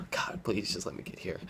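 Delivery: desperate whisper near tears; whispered prayer. Retold or imitated. Sleepy driver begging God, tearful whisper. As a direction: plead under your breath. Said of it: "praying while driving".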